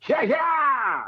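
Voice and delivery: high, wild whooping cry; rebel yell. Retold or imitated. Reenactor answers the request for a rebel yell with a high whooping shout. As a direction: let loose a Civil War rebel yell. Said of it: "give us a rebel yell".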